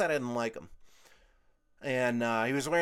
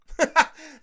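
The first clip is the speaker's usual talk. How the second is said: loud laugh; 13 semitones higher, 10 dB louder than usual. Real, not acted. Laughing after quote.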